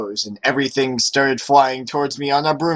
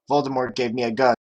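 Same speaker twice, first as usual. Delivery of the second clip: usual speaking voice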